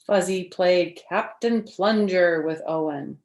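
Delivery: bright, lively storybook read-aloud; reading to children. Acted. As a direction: read it like story time for little kids. Reads picture book to children.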